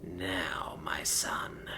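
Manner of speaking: low slow whisper, softly ominous; calm menace. A narrator's reading. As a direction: calmly deliver a deadly order. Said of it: "he said calmly".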